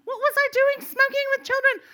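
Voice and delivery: high, loud hammed-up wail; horrified self-reproach. Mother berates herself the morning after, shrieking mock horror. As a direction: rise to a squealed mock panic.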